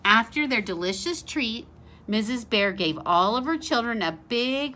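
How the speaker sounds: usual speaking voice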